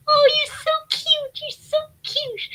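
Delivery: high, sugary mock coo imitating him. Mimicking her boyfriend cooing at her gardening, high mock coo.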